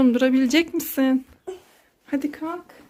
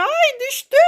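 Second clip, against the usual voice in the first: high, startled gasp of ay; mock surprised cry; 11 semitones higher than usual. Mother sees her daughter fall over in the game, high mock-startled cry. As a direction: pretend alarm as she tumbles.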